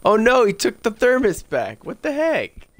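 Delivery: high loud alarmed cry; startled yelp. Real, not acted. Gamer yelping at the groundskeeper taking the thermos, high loud alarmed. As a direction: yelp in real alarm as he grabs it back.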